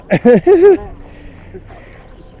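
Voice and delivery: squealing high laugh of delight. Real, not acted. Tourist laughing at a baby elephant, high delighted squeal. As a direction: laugh out high and loud with joy.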